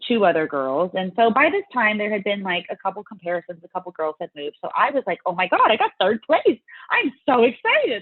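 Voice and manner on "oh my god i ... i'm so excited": higher, louder replay of excitement; re-enacted thrilled outburst. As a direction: gush it out, stretching the excitement. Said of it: "Like, I was thrilled".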